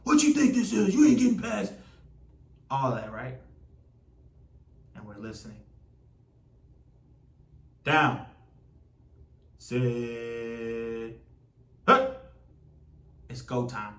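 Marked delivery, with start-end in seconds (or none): 0.0-1.7 taunting defender, building to a high shout; rowdy on-field trash talk. Acted. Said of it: "Defense is talking noise". 7.8-12.3 quarterback snap count. Acted.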